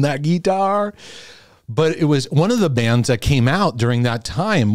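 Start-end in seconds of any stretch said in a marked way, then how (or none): 0.0-0.9 mock Southern. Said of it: "They weren't talking like that".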